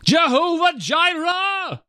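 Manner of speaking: high, slow cry to the sky. Acted. Old father crying out to the empty sky, high and slow. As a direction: call out the name of God, high and stretched. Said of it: "Abraham cried out at the sky".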